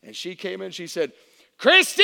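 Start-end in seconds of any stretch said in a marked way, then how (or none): 1.6-2.0 high, booming call of a name; big-lunged doctor shout. Retold or imitated. Doctor bursting in to the mother, imitated shout. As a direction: bellow it like a tiny doctor with huge lungs. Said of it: "man, she had lungs".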